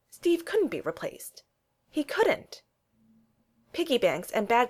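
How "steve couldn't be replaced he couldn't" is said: couldn't pressed higher, slow; insistent stress. A narrator's reading. Narrator insisting Steve can't be replaced, stressed and slow. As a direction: hammer home that it can't be.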